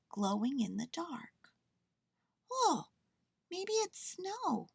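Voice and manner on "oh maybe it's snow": high, slow, wondering little bear; very high-pitched excited wonder. A narrator's reading. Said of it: "he said excitedly".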